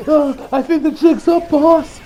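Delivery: high, slowed nervous panic; a panicked underling fearing he's caught; mildly hammed-up jittery worry; freaking out. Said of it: "Doppio starts to freak out".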